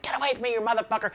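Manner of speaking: high, restrained mock outburst; high-pitched mock fury, kept down; retold cussing-out. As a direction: imitate an angry co-star at a high pitch, without volume. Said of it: "having cussed out up and down".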